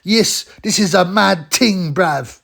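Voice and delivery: hammed-up London street slang voice; Roadman impression. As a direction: take off a wannabe Roadman host. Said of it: "he calls everyone bruv".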